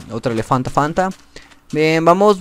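ordinary talk, nothing marked

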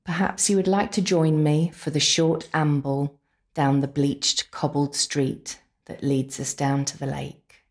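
ordinary talk

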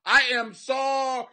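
loud, slow, hammed-up apology; overdone public apology to the camera; mock camera apology. Comic to his viewers, fake-sincere loud apology. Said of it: "look in the camera and say".

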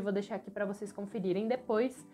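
normal talking voice

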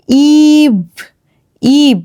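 ইভ with a drawn-out 'ee'; long E sound. Shown as a demonstration. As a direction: stretch the vowel of ইভ, ending on v. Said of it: "সেটিকে long করে দেয়". (লম্বা 'ঈ' দিয়ে ইভ)

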